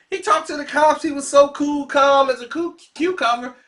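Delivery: normal talking voice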